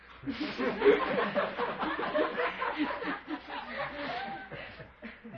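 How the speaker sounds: long, high wheezing laugh; helpless high-pitched laughter that runs on; real laughter. Real, not acted. Teacher amused by the answer he just read, laughing hard.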